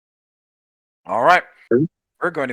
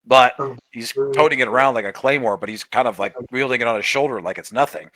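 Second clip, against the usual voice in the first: ordinary talk, nothing marked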